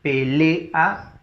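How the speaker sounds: "Pelea" sounded out by syllables, stressed on LE. Shown as a demonstration. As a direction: say pe-LE-a, leaning on the LE of "Pelea". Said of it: "le lleva la mayor entonación". ("Pelea" con la mayor entonación en le)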